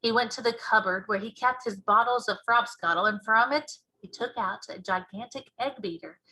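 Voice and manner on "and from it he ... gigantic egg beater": usual speaking voice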